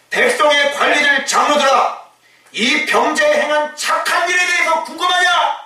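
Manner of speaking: high ringing challenge; Peter's bold shout. Retold or imitated. Preacher voices Peter challenging the council, shouting. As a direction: throw the question at the council. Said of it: "이렇게 얘기하는 거죠".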